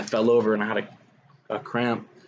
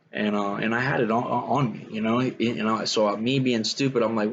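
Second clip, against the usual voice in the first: normal talking voice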